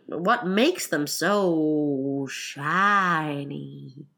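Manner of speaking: very slow, stretched-out last words; drawn-out questioning. Acted. Griffin asks about Alice's shoes, greatly drawn out. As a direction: linger long on so shiny.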